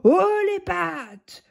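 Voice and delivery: slow, drawn-out menacing command; terrible icy voice. Acted. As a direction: freeze the fox with cold menace. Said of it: "d'une voix terrible, froide comme la glace".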